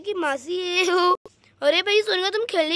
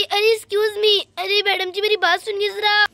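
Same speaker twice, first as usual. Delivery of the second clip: ordinary talk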